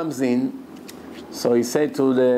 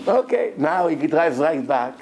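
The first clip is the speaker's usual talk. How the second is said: usual speaking voice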